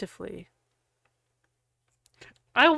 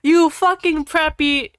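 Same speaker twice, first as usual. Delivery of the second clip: louder, higher hammed-up insult; mock angry shout; 6 semitones higher, 6 dB louder than usual. Sirius insulting Draco, hammy angry shout. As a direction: spit out the insult like a cartoon brawler. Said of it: "Sirius shouted angrily".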